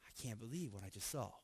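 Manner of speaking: barely able to speak after the miracle; stunned disbelief. Retold or imitated. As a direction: crowd stunned after seeing the fire fall, a hushed awed murmur. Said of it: "everybody's going".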